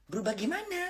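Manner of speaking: high-pitched, incredulous re-enacted question; retold puzzled question. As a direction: act out being baffled, pitch jumping up. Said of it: "saya bilang gitu".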